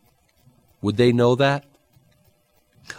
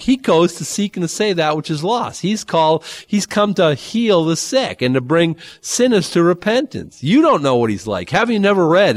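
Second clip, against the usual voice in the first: raised, emphatic mock scolding; indignant rebuke; 7 semitones higher than usual. Jesus telling critics they don't know God, emphatic indignant rebuke. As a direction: drive each point home as a pitched-up correction. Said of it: "is wh-, how he says it".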